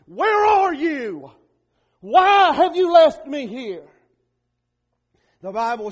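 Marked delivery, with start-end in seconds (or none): 0.1-3.9 slow, anguished cry, louder and higher. Retold or imitated. Preacher voicing Jesus on the cross, slow anguished cry. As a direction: stretch each word out like a man abandoned. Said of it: "And he's crying out".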